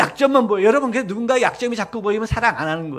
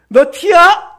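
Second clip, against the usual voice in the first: mocking loud jab; 7 semitones higher, 9 dB louder, much slower than usual. Mocking question to pastor.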